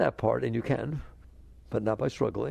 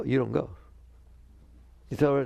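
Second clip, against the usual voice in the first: normal talking voice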